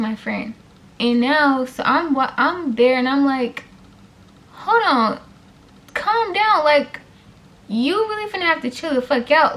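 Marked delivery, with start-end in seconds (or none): 4.5-9.6 higher, firmer call to calm down; retold raised voice. Narrator telling the screaming mom to calm down, raised. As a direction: step in and tell them to chill.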